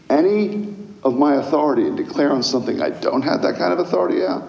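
ordinary talk

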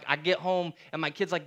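usual speaking voice

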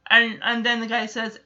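usual speaking voice

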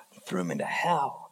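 stunned listener reacting to the parable; shocked listener. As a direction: sound aghast at the story. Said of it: "And everybody listening goes".

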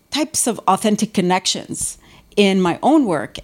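ordinary talk

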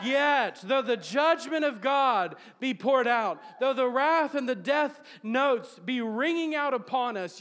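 preacher proclaiming Habakkuk's joy to the congregation; full-force preaching. Acted. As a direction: preach it out with rising fervor.

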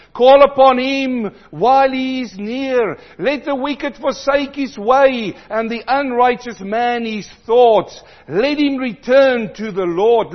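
loud, soaring preacher voice; preaching at full force. Acted. Preacher urging the congregation to return, at full force. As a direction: call it out over the church.

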